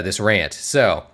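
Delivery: usual speaking voice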